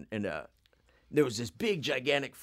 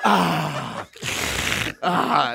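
mock sniff sigh; 6 semitones higher, 10 dB louder than usual. Imitating Flea sniffing the shoe.

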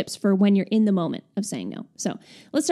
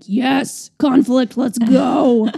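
louder, higher mock eagerness; eager for conflict; 3 semitones higher, 6 dB louder, much slower than usual. Imitating conflict-lovers, hammed-up eager shout. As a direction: pump yourself up for a fight. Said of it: "people that are like".

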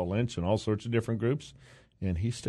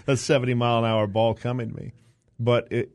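ordinary talk

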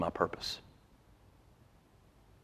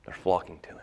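whisper; 4 dB quieter, faster than usual. Real, not acted. Hushed aside in sermon.

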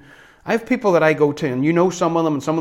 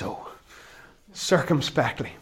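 usual speaking voice

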